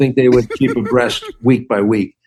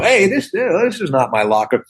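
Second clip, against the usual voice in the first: higher-pitched mock protest; re-enacted ad-lib with a raised voice; protesting film line; 6 semitones higher than usual. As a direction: call out a feeble excuse, pitched up. Quoting his ad-libbed film line.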